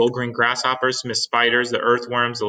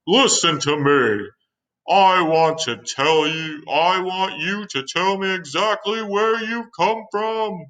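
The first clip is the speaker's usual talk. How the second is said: calling up; 8 semitones higher, 4 dB louder, slower than usual. Acted. Chief calls up to centipede.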